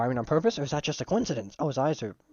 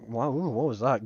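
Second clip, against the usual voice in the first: usual speaking voice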